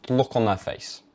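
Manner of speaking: ordinary delivery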